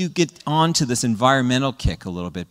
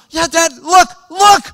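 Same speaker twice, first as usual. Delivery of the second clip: put-on much higher, louder eager child; slow, high, loud childish outrage; excited son; 13 semitones higher, 7 dB louder, slower than usual. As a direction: kid insisting dad look, then tattling. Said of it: "the son goes".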